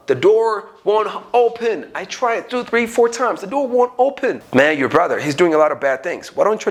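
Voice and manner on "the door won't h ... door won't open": higher-pitched, frustrated complaint about the stuck door. Acted. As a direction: whine that the door just won't open. Frustrated person who can't open door.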